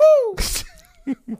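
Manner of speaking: high, loud hooting cheer; excited whoop. Real, not acted. Friend whoops at a crude joke, high and loud.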